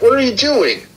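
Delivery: very high-pitched retold outburst; mock alarmed cry. Retold alarmed off-screen reaction to gun.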